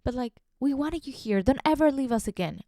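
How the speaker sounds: lightly hammed pleading customers; clingy customers. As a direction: whine like regulars who missed you. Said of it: "They're like".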